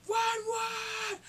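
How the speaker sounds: very high, loud battle shout; excited shouting count. Acted. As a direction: yell it like rallying troops.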